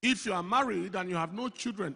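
ordinary talk

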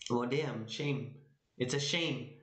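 ordinary delivery

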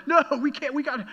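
mock upset protest. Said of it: "I'm like".

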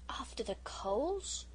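whispered, slow question; hushed repeat. A narrator's reading. Phyllis whispers to Peter, slow and hushed. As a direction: breathe the question to your sister. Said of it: "whispered to her sister".

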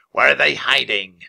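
loud, lightly rasped demand; a hint of raspy bird-king; raspy Raven King. A narrator's reading. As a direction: demand answers as a raspy villain. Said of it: "he asked in a raspy voice".

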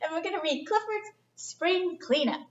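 ordinary delivery, nothing marked

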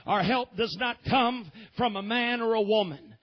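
ordinary talk, nothing marked

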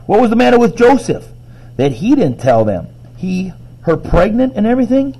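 high-pitched grumbled complaint; grumbling. A narrator's reading. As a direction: grumble in indignant questions. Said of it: "she grumbled".

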